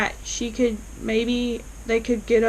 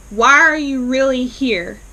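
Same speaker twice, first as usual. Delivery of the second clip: demanding; 2 semitones higher, 6 dB louder than usual. Retold or imitated. Aunt Sarah confronting Robert at lunch.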